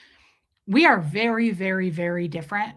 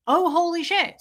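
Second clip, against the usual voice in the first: shocked; 8 semitones higher than usual. Retold or imitated. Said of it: "switch and it makes me very sad".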